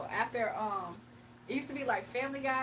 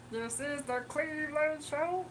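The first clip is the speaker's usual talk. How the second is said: slow, higher put-on cartoon voice; Cleveland Brown impression; 3 semitones higher, slower than usual. Streamer attempts Cleveland Brown's voice for chat, slow and higher. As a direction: drawl it out as Cleveland. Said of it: "I used to be able to do Cleveland Brown".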